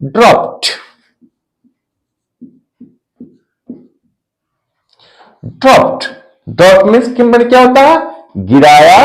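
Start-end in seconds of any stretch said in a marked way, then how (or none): 0.0-6.0 ड्रॉप्ड as "dropt", released T at the end; final -ed as T. Shown as a demonstration. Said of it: "नहीं बोलेंगे".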